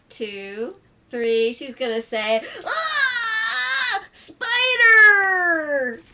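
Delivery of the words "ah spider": high, loud mock shriek; storybook scream. Mom finding a spider in the bathtub, hammed-up scream. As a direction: shriek in pretend horror, stretching the word.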